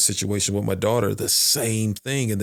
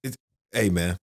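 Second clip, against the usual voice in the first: ordinary delivery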